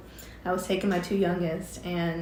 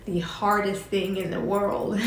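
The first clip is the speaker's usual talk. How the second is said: ordinary talk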